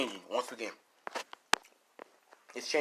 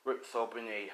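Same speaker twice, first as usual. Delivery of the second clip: ordinary talk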